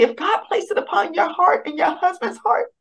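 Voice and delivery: lightly voiced calm counselor reassuring her; soothing advocate.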